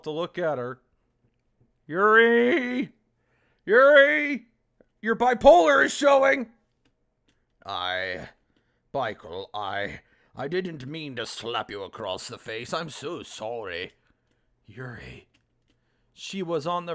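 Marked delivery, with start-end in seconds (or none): 1.9-6.5 loud mocking call out; yelling at screen. Real, not acted. 7.6-15.3 lower, soft timid apology; low voiced Yuri.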